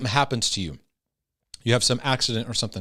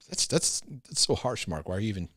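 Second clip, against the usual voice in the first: much softer, half-whispered scolding of himself; hushed self-rebuke; 4 semitones lower, 8 dB quieter than usual. Retold or imitated. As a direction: mutter it under your breath to yourself. Catching himself being harsh.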